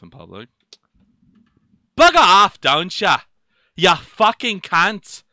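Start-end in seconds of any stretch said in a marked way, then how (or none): 1.9-5.2 loud, higher put-on English accent, veering Australian; shouted English-accented cursing, last words drawn out. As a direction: hurl the insult in a British-Aussie accent. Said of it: "You have a good English accent".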